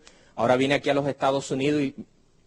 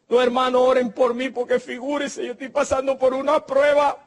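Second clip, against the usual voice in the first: higher, pleading voice of a troubled believer; pleading church member; 8 semitones higher than usual. Acted. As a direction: play the worried brother asking for prayer. Feligrés afligido pidiendo oración. (hermano afligido pidiendo oración, voz más aguda)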